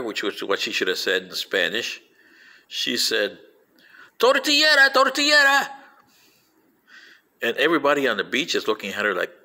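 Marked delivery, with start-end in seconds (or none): 4.2-5.7 very high, louder alarm cry; high mock-panicked shout; imitated yelling. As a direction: yell a warning across the beach, mimicking her. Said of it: "she's yelling at them".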